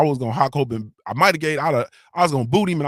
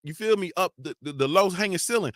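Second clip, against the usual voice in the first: usual speaking voice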